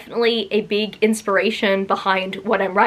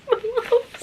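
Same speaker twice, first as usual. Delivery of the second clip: higher coo; 13 semitones higher than usual. Real, not acted. Echoing herself over her loves, a higher coo.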